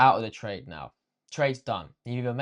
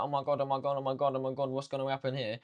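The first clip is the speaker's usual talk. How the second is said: mock flustered panic; 5 dB quieter, faster than usual. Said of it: "all hot and flustered".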